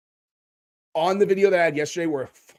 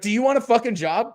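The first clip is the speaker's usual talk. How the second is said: quoted title; 6 semitones higher than usual. Retold or imitated. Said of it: "Do you want a job?".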